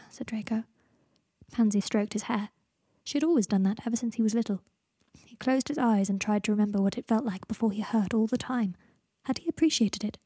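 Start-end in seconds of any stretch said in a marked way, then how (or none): none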